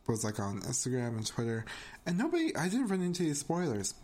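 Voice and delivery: usual speaking voice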